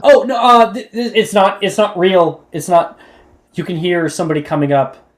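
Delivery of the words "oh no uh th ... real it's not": high, flustered, laughing denial; panicky protest. Acted. Student caught with a snake, flustered high panic. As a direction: babble it out in a panic.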